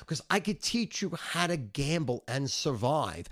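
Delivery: ordinary talk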